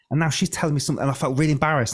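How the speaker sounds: ordinary talk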